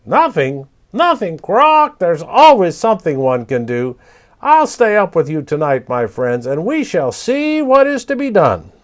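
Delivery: high, loud squawking parrot. Acted. Papagayo rallying the night creatures, loud high parrot voice. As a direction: bold bird rallying his scared friends.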